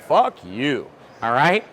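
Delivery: telling off whiners as a retold rant; mock rant. To people moaning about plastic racks, a building rant. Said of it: "I'm like".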